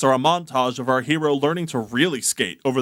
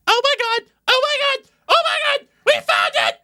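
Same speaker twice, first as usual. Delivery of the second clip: very high, loud excited squeal; shrieking with joy; 4 dB louder, faster than usual. Acted.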